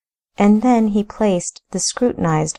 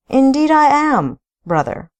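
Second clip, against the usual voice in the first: breezy confident reply; 7 semitones higher, 4 dB louder than usual. Acted. Younger brother answering cheerfully.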